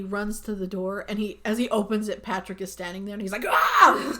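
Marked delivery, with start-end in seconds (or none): 3.5-4.2 loud, high mock scream of fright; startled scream. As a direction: imitate a freaked-out shriek. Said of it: "And he freaks himself out".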